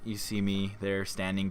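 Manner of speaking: ordinary talk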